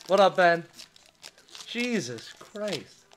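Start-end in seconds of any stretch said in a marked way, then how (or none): 1.7-3.0 low, soft, awed murmur; stunned disbelief. Real, not acted. Breaker taking in Joe Burrow pull, quiet disbelief. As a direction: let it sink in quietly, amazed. Winding down after huge pull.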